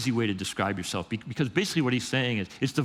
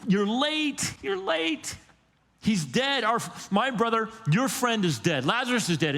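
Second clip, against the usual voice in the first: a sister's hurt accusation; high-pitched, distraught Martha blaming Jesus; upset Martha; 10 semitones higher than usual. Retold or imitated. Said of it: "Martha's like".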